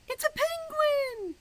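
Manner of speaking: excited reveal. Acted. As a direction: burst out with delighted surprise. Revealing the answer to children.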